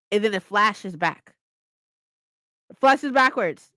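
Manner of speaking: ordinary talk, nothing marked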